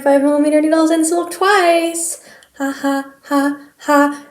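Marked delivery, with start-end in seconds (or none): 2.6-4.3 slow sarcastic ha ha ha; deadpan mock laughter. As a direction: fake a knowing laugh at her own mishap. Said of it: "That's foreshadowing".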